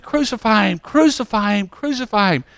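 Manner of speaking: high, repeated mock cry without added volume; high-pitched mob chant; imitated screaming. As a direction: act out a screaming crowd at held-back volume. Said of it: "Sitting there screaming".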